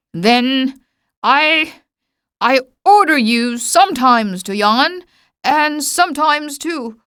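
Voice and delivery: a hint of royal sputter; sputtering king. A narrator's reading. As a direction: stumble over the order, mildly vexed. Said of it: "he sputtered a little".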